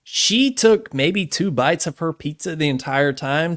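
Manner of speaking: ordinary delivery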